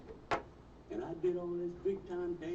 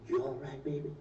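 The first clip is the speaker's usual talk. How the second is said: normal talking voice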